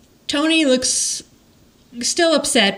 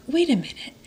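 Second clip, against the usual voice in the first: hushed realisation; 5 semitones higher, 5 dB quieter, faster than usual. Retold or imitated. Tony notices daughter walk in.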